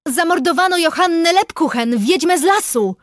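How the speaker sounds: high, loud shouted reply; shouting back. A narrator's reading. Investigator tells forester of the witch's murder, shouting back. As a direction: call the news back to him. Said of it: "odkrzyknęłam".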